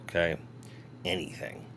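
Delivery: whispered Anything, very soft and breathy. Shown as a demonstration. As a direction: whisper the word Anything to yourself.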